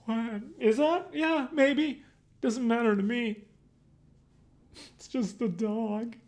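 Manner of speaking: high, badly faked not-caring voice; terrible fake nonchalance. Kid on the bus faking indifference about his dead dog, badly. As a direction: pretend you don't care and overdo it. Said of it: "That was some terrible acting".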